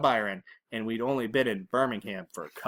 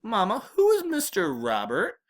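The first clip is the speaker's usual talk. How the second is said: higher-pitched, uneasy questioning; worried mama; 7 semitones higher than usual. A narrator's reading. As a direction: ask your mother with rising worry. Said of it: "Mama sounded worried".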